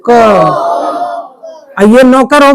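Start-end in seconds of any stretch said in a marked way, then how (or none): 0.1-0.8 "क" drawn out with its whole vowel; full KA sound. Shown as a demonstration. Teacher tells class to say it first, "क" drawn out. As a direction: hold "क" long and complete. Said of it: "क का पूरा क बोलो".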